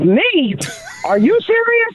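retold disbelief, voice jumping up high; retold stunned disbelief, pitch shooting up; shocked disbelief. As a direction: act stunned at the news, pitch leaping. Retelling shock at therapist's news.

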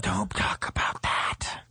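low, slow whisper; whispered hush. Real, not acted. Host hushing cohost about the weed, whispering. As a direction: hush them conspiratorially.

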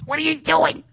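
very high, squeaky buddy voice; monkey voice. Acted. Talking to his friend Munchak in their monkey voice. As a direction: chatter like a cartoon monkey. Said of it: "full conversations in this voice".

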